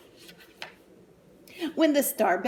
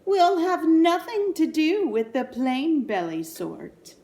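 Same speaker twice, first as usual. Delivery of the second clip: louder, higher snooty put-down; snooty snort; 6 semitones higher, 5 dB louder than usual. Acted. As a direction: sniff at them from a height. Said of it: "they would sniff and they'd snort".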